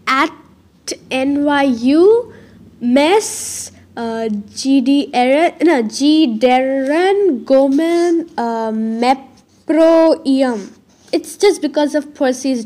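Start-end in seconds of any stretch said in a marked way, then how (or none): 0.1-10.7 slow, stumbling sound-it-out reading; halting misreading. Shown as a demonstration. Narrator struggling to read a cursive neon sign slowly. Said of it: "I'll try to read as much as I can read".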